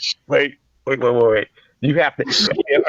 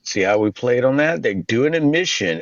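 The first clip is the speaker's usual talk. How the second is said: ordinary talk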